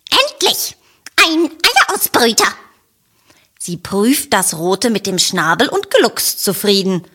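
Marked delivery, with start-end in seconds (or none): 0.1-2.5 very high, triumphant cackle; excited hen. A narrator's reading. Adele the hen pouncing on it, high and excited. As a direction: cluck it out in triumph.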